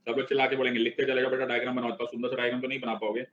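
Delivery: ordinary talk, nothing marked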